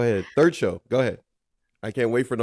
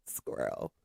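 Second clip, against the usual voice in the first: whisper; 12 semitones lower, 12 dB quieter than usual. Real, not acted. Whispered aside.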